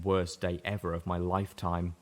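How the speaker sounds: plain reading voice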